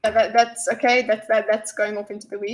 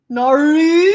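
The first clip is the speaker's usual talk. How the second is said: loud, rising Aussie "Naru"; exaggerated Aussie no; 4 semitones higher, 12 dB louder than usual. Shown as a demonstration. Streamer trying chat's spelling of Aussie no, exaggerated Australian accent. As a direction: push "Naru" up high and loud in mock-Australian. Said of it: "but the correct spelling is nar".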